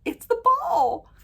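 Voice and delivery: high, drawn-out squeal; thrilled reveal. Retold or imitated. Revealing the ball to friend, high thrilled squeal. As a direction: squeal it slowly in disbelief.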